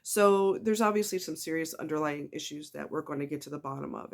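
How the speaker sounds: normal talking voice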